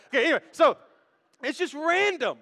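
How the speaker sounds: usual speaking voice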